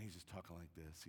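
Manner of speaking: very soft, low speaking voice; light talker. Retold or imitated. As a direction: imitate a very soft-spoken guest speaker. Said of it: "light talker on the planet".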